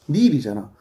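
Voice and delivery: ordinary delivery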